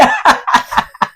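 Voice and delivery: breathy little laugh at her own joke; a short airy chuckle; amused laugh. Real, not acted.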